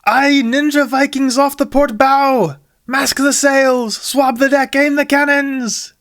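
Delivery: high, loud, slow captain's orders; yelling captain. Acted. Jack commands his crew against ninja Vikings, yelling. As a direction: bellow orders across the deck. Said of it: "yells Jack".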